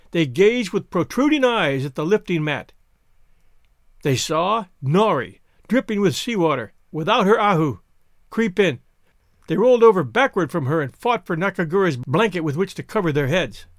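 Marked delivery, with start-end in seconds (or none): none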